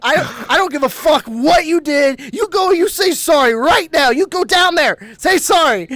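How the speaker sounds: loud, high exasperated ordering; retold furious telling-off, hammering each command; acted angry scolding. Acted scolding of friend to apologize.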